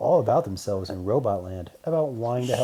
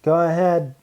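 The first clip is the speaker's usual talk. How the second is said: robot man voice; 6 semitones higher, 8 dB louder than usual. Acted.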